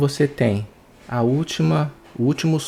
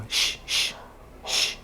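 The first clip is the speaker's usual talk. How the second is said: soft hushing sh, breath only; short repeated sh with no vocal buzz; voiceless "sh"; 7 dB quieter, much slower than usual. Shown as a demonstration. Teacher showing the cords don't vibrate, voiceless sh. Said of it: "não vibra".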